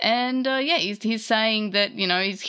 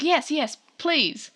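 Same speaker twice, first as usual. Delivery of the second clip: higher-pitched mock eagerness; eager pleading; 7 semitones higher, slower than usual. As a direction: lightly act out an eager plea. Retold eager pleading answer.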